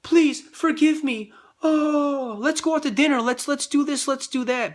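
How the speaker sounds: high, whiny begging with a bribe; mock groveling apology. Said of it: "and say".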